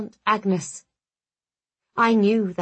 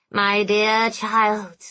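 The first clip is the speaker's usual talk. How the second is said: very slow, drawn-out fond address with menace; disturbingly human monster; much slower than usual. A narrator's reading. Skinless monster approaches cornered narrator, disturbingly human. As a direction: stretch the endearment out, eerily human. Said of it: "his voice disturbingly human".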